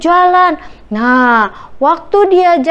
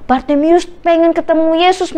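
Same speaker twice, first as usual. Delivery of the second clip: ordinary delivery, nothing marked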